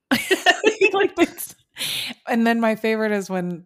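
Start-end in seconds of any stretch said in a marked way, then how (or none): 0.0-1.6 high, slow words through laughter; can barely talk for laughing. Real, not acted. Laughing over favourite lines.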